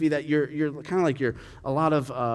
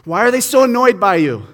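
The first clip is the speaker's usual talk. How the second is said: louder, higher demanding questions; irritated Pilate; 6 semitones higher, 9 dB louder than usual. Retold or imitated. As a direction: press the prisoner impatiently. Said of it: "the last thing Pilate said to Jesus".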